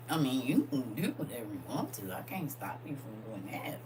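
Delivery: ordinary talk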